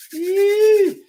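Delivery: a high, loud whoop of excitement; a big excited whoop at the viewer's question; excited yee. Real, not acted. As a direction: let out a loud hype yell. Hype cheer for a good question.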